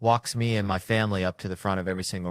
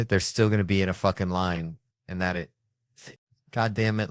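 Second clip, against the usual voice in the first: ordinary delivery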